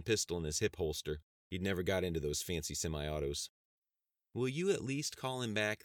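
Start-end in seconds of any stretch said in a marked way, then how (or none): 4.3-5.8 higher, pleading voice; imploring. A narrator's reading. Elderly secretary to sheriff in the doorway, imploring. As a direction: implore him gently. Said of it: "the elderly secretary implored him".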